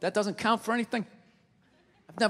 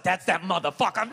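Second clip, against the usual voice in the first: dog voice; 3 semitones lower than usual. Acted. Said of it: "the dog's like".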